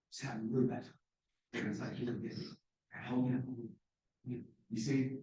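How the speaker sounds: faint, drained voice after panic; weak and faint. Shown as a demonstration. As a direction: fade to a weak near-whisper. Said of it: "I'm having an anxiety attack right now".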